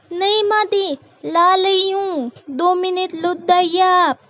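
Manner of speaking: put-on high, childlike lisp, placating; meek lisping bahu calling back; lisping daughter-in-law. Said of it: "समझी तोतली बहू".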